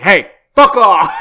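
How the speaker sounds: loud, drawn-out mock rebuff; brusque. Man on payphone to woman waiting, brusque mock shout. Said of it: "he's gotta be so brusque".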